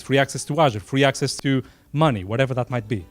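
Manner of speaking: usual speaking voice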